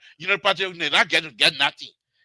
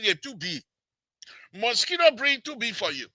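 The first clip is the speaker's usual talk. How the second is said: usual speaking voice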